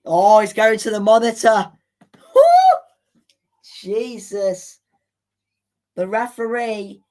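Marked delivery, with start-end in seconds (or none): 0.1-1.7 loud, urgent cry as the ref heads to VAR; real fan shouting at the screen; excited shout. Real, not acted. VAR check at tense moment. 2.4-2.8 very high, loud whoop with a laugh; real excited whoop; whooping yell. Real, not acted. Reacting to VAR check.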